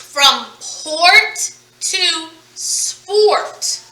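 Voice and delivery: "port to sport" said very slowly, the s drawn out; slow demo read. Shown as a demonstration. Teacher slowing down port to sport for students. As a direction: stretch "sport" so the added s stands out. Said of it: "adding another sound at the beginning".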